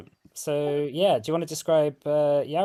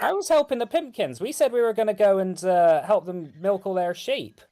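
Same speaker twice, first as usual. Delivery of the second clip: defensive mother; 7 semitones higher than usual. Acted. GM voicing mother's excuse.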